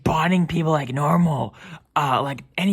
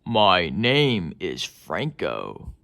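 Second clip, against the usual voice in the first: much lower, slow and heavy; deep slow voice; 9 semitones lower, much slower than usual. Acted. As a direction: drop into a deep slow voice to say the name. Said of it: "Why does everybody sound like that".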